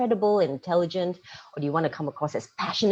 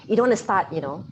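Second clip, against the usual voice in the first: nervous start; 3 semitones higher, 6 dB louder than usual. Shown as a demonstration. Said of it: "like this".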